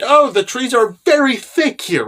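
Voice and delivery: a hint of a timid boy, drawn out; lightly voiced Nasa. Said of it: "Nasa points out that".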